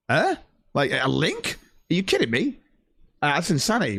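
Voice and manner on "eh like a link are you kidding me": retold disbelief. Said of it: "And I'm like".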